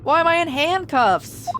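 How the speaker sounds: loud, high startled outburst; alarmed bewilderment. Acted. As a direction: wake up shocked and demand an explanation. Game character wakes up handcuffed.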